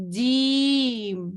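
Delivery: جیم stretched very long and slow; drawn-out jeem. Shown as a demonstration. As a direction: hold جیم out long for learners. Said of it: "کس طریقے سے پڑھنا ہے". (جیم لمبا کھینچ کر)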